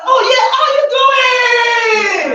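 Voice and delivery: high, loud, slow hammed-up hello; forced cheery greeting. Speaker mocking a masked worker greeting the world, hammed-up cheer. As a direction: overplay the fake happy greeting.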